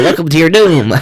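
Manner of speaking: hammed-up ominous host; wizard dungeon master. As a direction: announce it like a mock-epic wizard.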